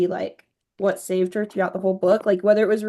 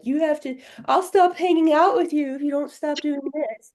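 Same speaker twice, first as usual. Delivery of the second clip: put-on childish whine; 5 semitones higher than usual. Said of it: "he acted like a little kid".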